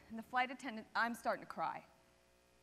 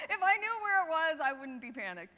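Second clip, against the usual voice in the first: exasperated outburst; 3 semitones higher, 8 dB louder than usual. Retold or imitated. Panicked passenger asked where she left her phone, exasperated. Exasperated reply to unhelpful question.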